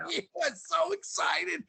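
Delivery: high, slow, laughing through the words. Real, not acted. Laughing while recalling the loud guy.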